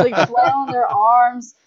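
ordinary delivery

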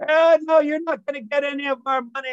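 high, loud and fast refusal; defiant shout. Retold or imitated. Tippy defying the robber, loud high shout. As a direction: snap back to protect the pot. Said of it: "Tippy says".

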